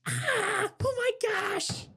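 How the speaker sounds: high, slow breathy gasp; mocking girly gasp. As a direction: imitate her exaggerated shock. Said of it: "Look at the face she made".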